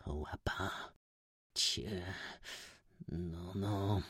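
low, hushed sneer; quiet, disbelieving taunt; mocking whisper. A narrator's reading. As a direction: murmur it derisively, very softly. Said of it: "szeptał urągliwie".

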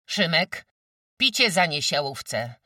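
loud, high, stern order; hard commanding voice. A narrator's reading. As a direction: call out a harsh command. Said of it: "rozkazała twardym głosem". (twardym, donośnym głosem)

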